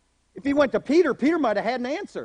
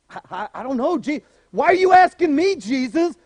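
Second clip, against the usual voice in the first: higher, louder startled reply; flustered; 3 semitones higher, 6 dB louder than usual. Retold or imitated. Philip answering Jesus, flustered and startled. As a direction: caught off guard, stammering.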